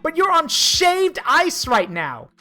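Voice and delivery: high, drawn-out mocking scoff; hammed-up indignant scorn; scornful ninja girl.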